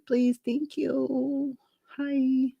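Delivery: mock sweet. Sweet-talking over text.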